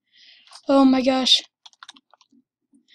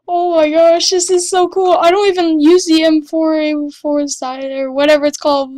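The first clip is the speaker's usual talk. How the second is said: thrilled rush of delight; excited; 3 semitones higher, 5 dB louder than usual. Real, not acted. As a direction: gush over a prize you can't believe. Excited over rare item.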